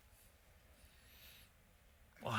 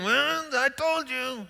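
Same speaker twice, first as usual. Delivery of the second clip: slow, high, self-satisfied drawl; smug; 5 dB louder, slower than usual. Retold or imitated. Someone gloating after a family argument, smug drawl. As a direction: savor being right. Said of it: "you sit there smugly going".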